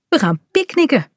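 bright, high cheerful call. Acted. Dad announcing the picnic to his daughter, cheerful and high. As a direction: call out happily about the picnic. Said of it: "roept Gijs vrolijk".

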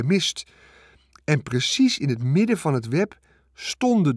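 high, loud, building amazement; excited proclamation. A narrator's reading. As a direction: proclaim a miracle, voice climbing as you go. Farmer excitedly reports miracle to wife.

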